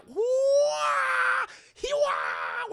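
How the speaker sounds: high, loud mock martial arts yell; mock kung fu cry. [name] bursting in to fight, high mock kung fu cry. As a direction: screech it like a movie kung fu fighter.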